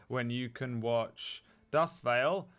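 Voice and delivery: normal talking voice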